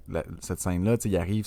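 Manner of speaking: normal talking voice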